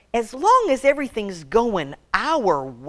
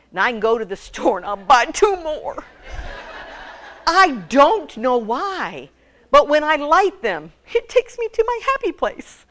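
giggling, rising up to a high happy place; amused and giddy, voice climbing; laughing confession; 7 semitones higher, 6 dB louder than usual. Real, not acted. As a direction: laugh at yourself as you confess. Laughing confession about candles.

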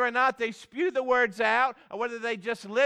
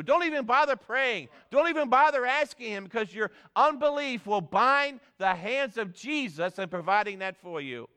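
ordinary delivery

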